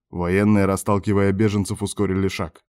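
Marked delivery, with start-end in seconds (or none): none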